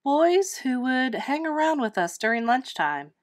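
plain reading voice

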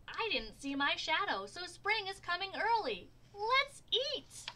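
high, loud cheering; rally everyone to eat; fake excited. A narrator's reading. Groundhog's trick announcement.

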